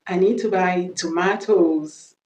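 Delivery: slow British "tomatoes", to-MAH-toes; British accent. Shown as a demonstration. Teacher modelling the plural, British accent. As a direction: say "tomatoes" slowly with a British long "ah". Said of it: "on va faire l'accent british".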